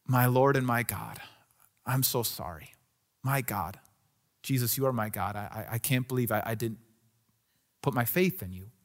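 low, hushed, remorseful confession; repentant. Acted. Thomas to the risen Jesus, softly repentant. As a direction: apologise softly, humbled and contrite. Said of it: "almost in a word of repentance".

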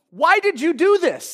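mildly voiced outrage; imitated anger. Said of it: "Instead of getting angry and going".

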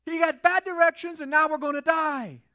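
loud, high, frantic complaining; panicked crowd. Pretend. Trapped Israelites complaining to Moses, loud and panicked. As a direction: whine it in full panic. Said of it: "They're like".

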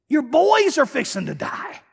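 high and slow, pleading with Eli; slow down and make him hear it; disbelieving rebuke. Real, not acted. Preacher confronting Eli about his sons, incredulous.

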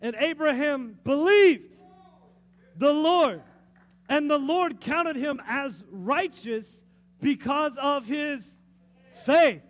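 booming slow proclamation of faith; proclaiming loudly. Real, not acted. Preacher booming to the congregation about faith. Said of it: "It's incredible".

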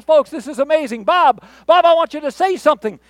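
high, loud showman's call to the crowd; faith healer shout. Acted. Faith healer to cheering crowd and Bob, mock excited shout. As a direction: work up the crowd like a stage healer. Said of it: "a faith healer that stands up".

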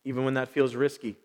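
low, soft prayer with long silences; quiet prayer. Real, not acted. Pastor asking the Holy Spirit to calm his heart, quiet prayer. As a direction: pray gently, pausing between short petitions. Stops to pray for focus.

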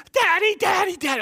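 put-on very high, loud little girl's scream; screaming child. Toddler in car seat screaming for her dad, screamed. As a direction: shriek for Daddy in alarm. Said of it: "she starts to scream".

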